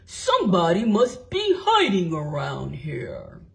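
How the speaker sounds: low, wary watchman; suspicious watchman. A narrator's reading. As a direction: search the dark store.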